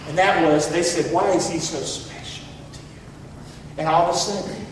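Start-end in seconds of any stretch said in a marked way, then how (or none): 1.1-3.8 soft, slow, breathless ask; whispered question. Acted. Daughters ask the bride in a soft slow whisper. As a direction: hush it like a secret.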